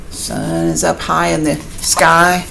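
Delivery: ordinary delivery, nothing marked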